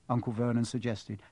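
ordinary delivery, nothing marked

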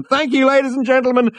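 high, loud showman's cry. A narrator's reading. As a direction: shout it out to the audience. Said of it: "cried Max".

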